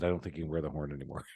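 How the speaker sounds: father breaking bad news quietly; dad's quiet verdict. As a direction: say it softly like a disappointed dad. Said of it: "my dad said".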